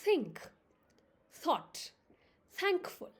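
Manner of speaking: think, thought, thankful with tongue between teeth; slow model of th in think; careful TH sounds. Shown as a demonstration. Teacher modelling TH words for learners, tongue between the teeth. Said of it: "Repeat after me".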